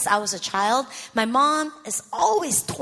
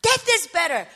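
raised, insistent acted cry; desperate outburst; 6 semitones higher, 4 dB louder, faster than usual. Worn-out daughter repeating it to God, voice raised. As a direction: insist on it, pitched up.

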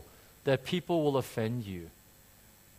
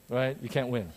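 ordinary talk, nothing marked

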